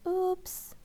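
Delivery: sheepish whisper; whispered oops. Acted. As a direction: whisper it, caught out. Miss Pickles embarrassed.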